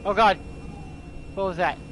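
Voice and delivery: loud, sudden cry of alarm; jump-scared yell; startled cry. Real, not acted. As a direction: blurt it out in fright.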